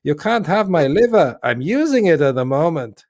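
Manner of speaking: hammed-up imitation of an indignant organ donor; Terry Gilliam's character. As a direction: put on a slow, high character voice.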